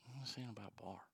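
whispered to himself. Real, not acted. Host muttering to himself trying to remember. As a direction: whisper the question under your breath. Trying to recall earlier point.

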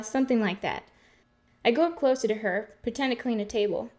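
usual speaking voice

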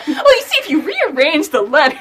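high, loud, through laughter; laughing mock explanation. Host mock-confessing to friends' teasing, loud and laughing. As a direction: play along with the joke, cracking up. Jokingly plays along with the accusation.

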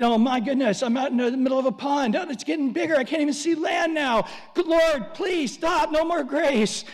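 high, loud, hammed-up panic, pleading to stop; a swimmer losing sight of land, begging loudly; mock panic. Believer flooded with grace begs the Lord, mock panic.

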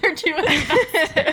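giggling through the words; laughing. Real, not acted. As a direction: laugh while you say it. Friends laughing at hand drawing.

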